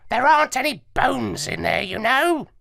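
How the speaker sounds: high squawky parrot, explaining; lecturing like a clever parrot. Acted. Parrot lectures the dog narrator, high and squawky.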